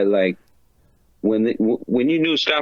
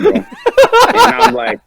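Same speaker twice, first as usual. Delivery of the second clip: laughing through it; 9 dB louder than usual. Real, not acted. Reads prank sticker aloud.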